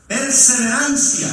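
loud, slow, drawn-out proclamation; proclaimed keyword. Real, not acted. Preacher proclaiming the sermon's key word to the congregation, loud and slow. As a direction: hit it loud and stretch it for the church. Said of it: "preacher proclaiming the key word".

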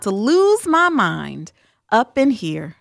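stretched out, slowed, comically desperate; exasperated prayer. Pretend. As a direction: drag out lose, like you're at your wits' end. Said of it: "that was my real prayer this morning".

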